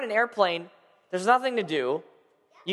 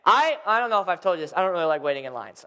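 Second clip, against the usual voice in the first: usual speaking voice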